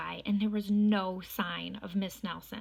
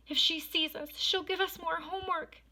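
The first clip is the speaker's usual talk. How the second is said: put-on higher, anxious kids' voice; scared kids; 9 semitones higher than usual. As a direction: fret like frightened children.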